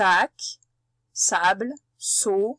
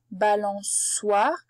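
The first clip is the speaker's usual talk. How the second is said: "balançoire" slowly in separated syllables; 2 semitones lower, much slower than usual. Shown as a demonstration. Teacher pointing out the cédille example, "balançoire" slowly by syllables. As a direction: draw out "balançoire" with the last syllable lifted.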